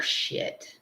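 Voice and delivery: a whispered shit; whispered curse. Retold or imitated. Viewer caught out, whispering the swear to themselves. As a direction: breathe the curse like you got caught. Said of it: "how many of you guys are like".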